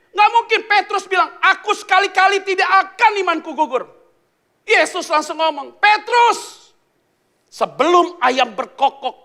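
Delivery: loud, high, building preaching; fiery sermon voice; preaching at full force. Acted. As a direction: drive the story home from the pulpit.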